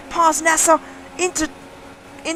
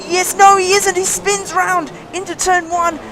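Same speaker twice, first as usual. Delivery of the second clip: excited; 7 semitones higher, 4 dB louder than usual. Real, not acted. Rival spins into turn one.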